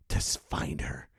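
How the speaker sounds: ordinary talk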